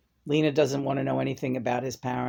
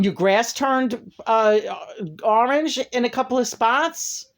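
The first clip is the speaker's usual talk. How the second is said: higher, louder furious tirade at the neighbour-in-law; angry rant; 7 semitones higher, 3 dB louder than usual. Real, not acted. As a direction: let it boil over, yelling at her through the camera. Ranting at the mother-in-law.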